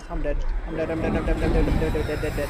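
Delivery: rapid loud panicked chant; panicking as it catches you; being chased. Real, not acted. Dying to the predator.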